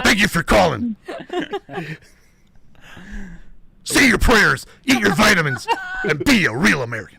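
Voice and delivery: loud, high wrestler shout; Hulk Hogan voice. Pretend. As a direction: hype it like a wrestling promo. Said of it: "hit him with the Hulk".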